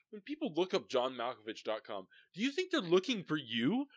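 high, loud, growing smug taunt; arrogant Malkovich. Retold or imitated. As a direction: needle him like a self-important star. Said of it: "He goes".

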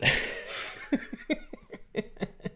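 quiet, wheezing laugh; airy chuckling that peters out; laughter. Real, not acted. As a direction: laugh yourself out of breath. Laughs after changing subject.

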